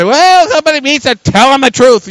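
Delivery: high, loud mock sanctimonious voice; a hammed-up judgmental churchgoer; mocking the self-righteous. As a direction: lampoon the finger-waggers. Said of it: "I've heard people say".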